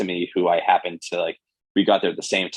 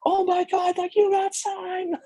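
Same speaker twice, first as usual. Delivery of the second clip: very high, slow excited squeal; pure excitement; slower than usual. Retold or imitated. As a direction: gush like thrilled parents. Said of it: "just in pure excitement".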